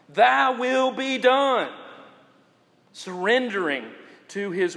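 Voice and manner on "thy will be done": slow, loud and raised, every word weighted; preacher's ringing affirmation; emphatic declaration. Real, not acted. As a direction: land it like the climax of the sermon.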